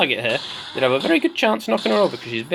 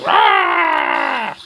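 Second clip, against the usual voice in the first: high, loud drawn-out roar; Bosk's roar; 9 semitones higher, 3 dB louder than usual. Acted. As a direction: roar it out like a creature. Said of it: "there goes old Bosk saying".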